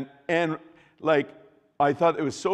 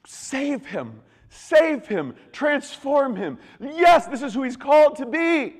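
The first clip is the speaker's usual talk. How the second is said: high, building plea to a jubilant shout; impassioned cry; 14 semitones higher, 9 dB louder, slower than usual. Acted. Spirit of God pleading for the condemned man, impassioned. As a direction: call out for his rescue with rising fervor.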